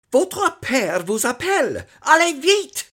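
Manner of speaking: high, loud fluttery French governess; frightened twitter. A narrator's reading. Governess hurries boy to his father, frightened twitter. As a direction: chirp it out like a frightened bird. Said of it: "twittering like a frightened bird".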